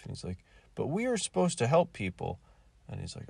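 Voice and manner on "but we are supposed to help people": mock whiny plea.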